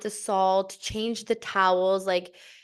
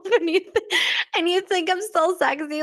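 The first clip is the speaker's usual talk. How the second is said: high, louder laughing incredulity; laughing disbelief; 10 semitones higher, 4 dB louder than usual. Retold or imitated. Asking husband, laughing and incredulous.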